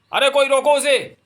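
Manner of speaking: very high, loud shriek to stop her; desperate yell. Acted. Farmer screaming for someone to stop the elephant. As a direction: scream across the field.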